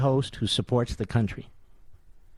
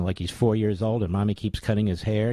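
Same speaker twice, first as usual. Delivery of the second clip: usual speaking voice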